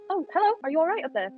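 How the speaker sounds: bright coaxing hello; sweet high greeting. Acted. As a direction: sweet-talk a hidden child. Greeting hidden child in tree.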